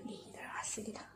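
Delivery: soft unvoiced whisper. Real, not acted. Girl checking if family is coming, whispering. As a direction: hush it so the family won't hear. Checking quietly if others come.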